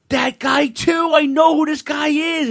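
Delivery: put-on high, loud excited blurt as a kid. Young fan to managers' fans, high excited blurt. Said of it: "And I was like".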